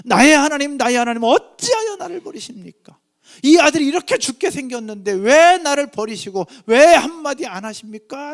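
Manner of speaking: loud, high impassioned cry, falling away at the end; anguished outcry. Acted. Preacher voicing Jesus on the cross crying to God, anguished outcry. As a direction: cry out in protest at being abandoned. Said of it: "절규하며 부르짖는데도".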